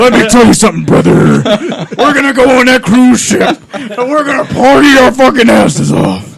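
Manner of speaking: light wrestler promo voice; hint of a hyped wrestler; wrestling promo. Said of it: "do it like a wrestling promo".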